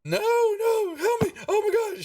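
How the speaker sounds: loud falsetto wail for help; hammed-up terrified squealing; crying halfling. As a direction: plead very high, in a panic. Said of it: "you know, crying".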